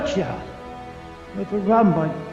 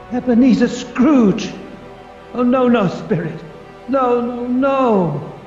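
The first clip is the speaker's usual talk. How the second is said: loud, anguished outburst; loud shocked denial; horrified cry; 4 dB louder than usual. Acted. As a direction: cry out in horror and refusal. Horror at own gravestone.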